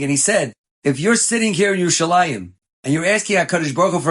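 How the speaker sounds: fervent rabbi exhorting his listeners; preaching. Acted. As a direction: preach it with fire to the congregation. Rabbi preaching opening with quote.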